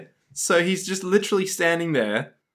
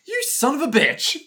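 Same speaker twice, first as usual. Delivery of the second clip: mock angry shout; 9 semitones higher than usual. Acted character outburst.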